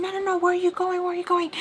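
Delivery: fast, high-pitched mock outburst; retold yelling. Friend stopping someone scooting away, high-pitched mock yell. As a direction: imitate her frantic challenge without much volume. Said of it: "she's, like, yelling at him".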